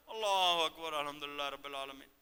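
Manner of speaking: low, loose mumbled rattle through "الحمدللہ رب العالمین"; کھلا ڈولا style. Shown as a demonstration. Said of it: "ہمارا سٹائل بھی ذرا کھلا ڈولا". (نیچی آواز میں لاپروا، کھلا ڈولا الحمدللہ)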